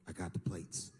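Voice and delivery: deadpan the news like a sister; low sister voice. Retold or imitated. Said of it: "she said".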